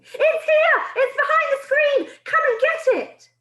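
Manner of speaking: very high, loud gleeful cry; a witch yelling that she found the boy; triumphant yell. Acted. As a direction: crow it out like you've won. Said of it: "yelled triumphantly".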